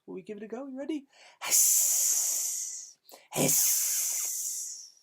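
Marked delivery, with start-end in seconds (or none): 1.4-4.9 long loud breathy hiss; leading the kids in a goose hiss. Shown as a demonstration. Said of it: "Can you hiss, boys and girls".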